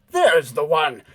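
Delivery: loud shout of discovery; a man spotting the culprit, shouting; accusing shout. Acted. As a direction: call it out, pointing him out. Man spots the intruder.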